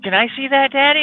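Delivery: put-on high, sweet little-girl voice; little daughter asking. Dad imitating his oldest girl asking to see the gun, high childlike voice. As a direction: ask it like an eager small girl. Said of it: "oldest was like".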